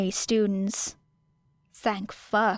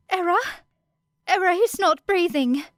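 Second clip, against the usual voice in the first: high, loud panicked call; a frightened classmate crying out; 10 semitones higher, 4 dB louder than usual. A narrator's reading. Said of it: "panic in her voice".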